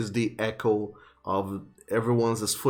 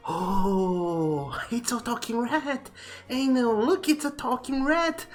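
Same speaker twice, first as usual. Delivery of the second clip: put-on high, eager children's voices; excited kids; 12 semitones higher than usual. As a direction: squeal like thrilled little kids.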